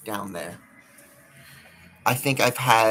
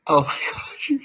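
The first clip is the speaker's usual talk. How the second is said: laughing. Real, not acted. Laughing at own thought.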